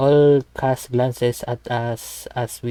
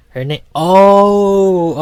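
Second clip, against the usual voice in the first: drawn-out groan; 8 semitones higher, 11 dB louder, much slower than usual. Real, not acted. Stumbles, groans forgetting name.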